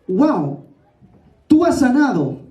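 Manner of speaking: usual speaking voice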